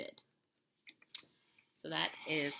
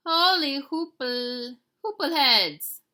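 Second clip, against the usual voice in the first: slow, high, loud holler; 8 semitones higher, 12 dB louder, slower than usual. A narrator's reading. Kitty boy hollering a warning, slow and loud. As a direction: holler it out in alarm, slowly. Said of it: "hollered Scapito".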